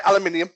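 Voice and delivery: "aluminium" the British way, al-yoo-MIN-ium. Shown as a demonstration. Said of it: "I'll get you to say".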